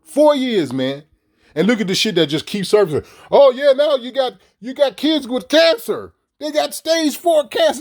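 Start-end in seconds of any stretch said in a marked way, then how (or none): 3.3-7.8 higher, mock-alarmed reporter voice; mock news report. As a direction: parrot the news in a raised mocking tone. Mocking news about kids.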